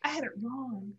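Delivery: softly repeated confession; tearful realization. Real, not acted. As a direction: admit it quietly, moved. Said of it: "I realized in that moment".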